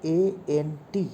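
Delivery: very slow letter-by-letter "এএনটি"; teacher slowly spelling out ant; spelled out slowly. Shown as a demonstration. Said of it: "যদি বলি পিঁপড়েটি".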